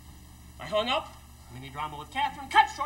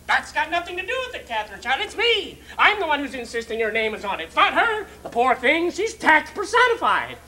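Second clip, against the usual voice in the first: indignant, raised-voice insistence; heated fiancée; 3 semitones higher, 7 dB louder than usual. Acted. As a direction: argue back hard, punching each point. Acts out Catherine arguing back.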